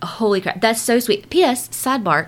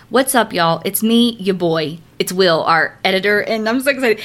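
ordinary delivery

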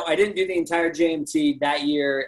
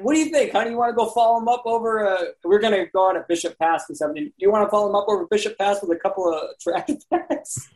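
higher, amused teasing question; ranger teasing his wife; 6 semitones higher, faster than usual. Retold or imitated. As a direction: playfully ask the wife, grinning. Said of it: "I remember his exact".

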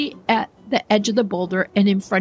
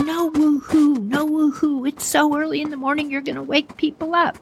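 mock yelling dad; 7 semitones higher than usual. Dad hushing his son at one in the morning, mock-yelled high scolding. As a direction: scold hurriedly in a pitched-up voice. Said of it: "Dad's yelling at him".

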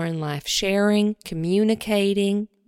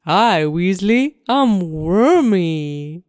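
slow, louder silly worm; worm puppet voice; 3 semitones lower, 5 dB louder, slower than usual. Acted. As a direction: bob along as a cheerful worm. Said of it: "it's a hand puppet".

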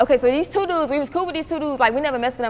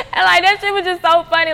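loud, high talk through laughter; laughing; 8 semitones higher, 8 dB louder than usual. Real, not acted. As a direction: say it while still cracking up. That shit was so funny.

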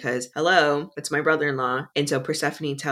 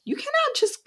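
mock high Psyche. Psyche scolding Eros, voiced.

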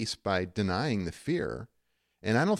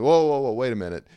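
loud, higher burst of protest; alarmed halt; 3 semitones higher, 9 dB louder, faster than usual. Retold or imitated. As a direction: pull someone up short, loudly. Acting out stopping self in denial.

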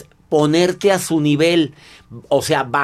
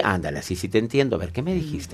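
soft, soothing acknowledgement; low voice; 5 semitones lower, 7 dB quieter than usual. Acted. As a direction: keep it hushed and accepting. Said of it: "sigue manteniendo la voz baja".

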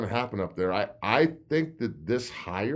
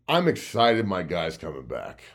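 ordinary talk

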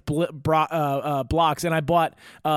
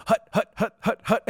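high, staccato marching chant; mock army march; 5 semitones higher than usual. Imitating buddy marching oar to the water, mock military chant. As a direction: count it out like a comic soldier. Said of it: "like he was going to war".

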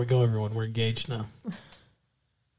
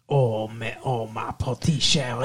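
mock Creole accent; 4 semitones lower, 3 dB quieter than usual. Boyfriend offering fiancée a mock Creole accent instead. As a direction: switch to a put-on Creole accent. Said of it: "How about some Creole?".

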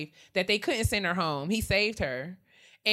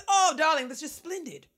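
lightly voiced grand fashion icon; a hint of a lofty transatlantic gentleman; Andre Leon Talley impression; 6 semitones higher than usual.

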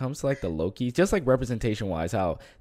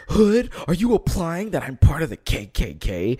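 indignant troll; 6 semitones higher, 5 dB louder than usual. Retold or imitated. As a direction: play the offended commenter, voice jumping up. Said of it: "he was like".

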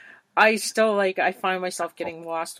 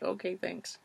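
soft mock resignation; small, quiet mock acceptance; deflated retold reply; 11 dB quieter than usual. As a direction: give in softly, as a retold comeback. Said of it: "I'm like".

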